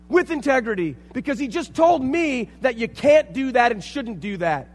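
loud, rising sermon with passion; fiery preaching. Acted. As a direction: preach it with fire and conviction. Preaching at full force.

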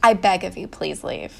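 low, hushed retold plea; imitated near-tears plea. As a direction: drop softly into a mock wounded plea, fading out. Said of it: "seems like she's about to cry".

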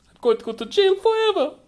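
high, whiny worried voice; high anxious voice. Acted. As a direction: play it panicked and high. Sheer despairs, nervous voice.

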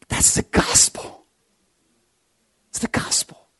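soft unvoiced preacher whisper; hushed whisper. Real, not acted. Preacher softly whispers the gospel to listeners. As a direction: let the gospel line fall to a breath.

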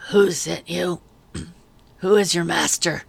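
loud, raw rasp with a throat-clear. A narrator's reading. Officer interrogates the girl, loud and rasping. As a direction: scrape the words out of a sore throat. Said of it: "His voice sounded raw".

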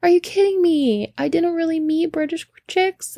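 high-pitched mock dismay; dismayed disbelief. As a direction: imitate someone whose great story just collapsed. Friend's retold dismay.

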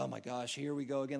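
low, soft aside of annoyance; fed-up, under-the-breath groaning; weary exasperation. Acted. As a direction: grumble quietly, tired of hearing it. Weary friends hear it again.

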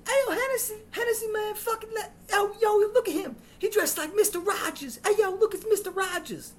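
put-on very high, loud jeering teen voice; mocking teen. Twelfth graders taunting the substitute about his outfit. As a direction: jeer at the new sub like a punk kid.

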